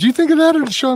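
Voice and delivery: laughing. Real, not acted. Amused by S0's joke.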